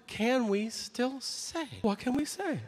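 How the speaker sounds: mock fretful audience member; whiny audience voice. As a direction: audience member whining at Tom. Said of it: "going like".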